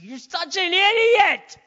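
hammed-up accuser yelling insults; devil reaming someone out. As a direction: berate them at the top of your voice. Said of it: "he just reams you out".

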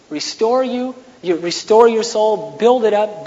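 normal talking voice

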